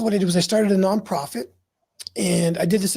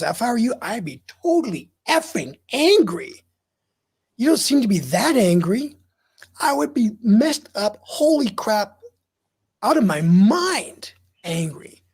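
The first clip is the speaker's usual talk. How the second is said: retold emphatic outburst, voice raised and swooping; mock-angry therapist; 3 semitones higher than usual. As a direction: act out indignant anger on someone's behalf, stretching the key words. Acting therapist's emphatic anger at him.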